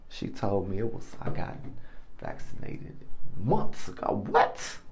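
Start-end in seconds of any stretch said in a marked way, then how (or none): none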